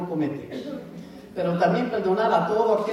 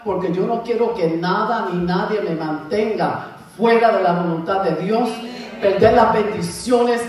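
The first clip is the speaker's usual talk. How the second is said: preaching at full force, pressing each line; 4 dB louder than usual. Real, not acted. Preacher urging the church to forgive, at full force. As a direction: drive the point home to the congregation.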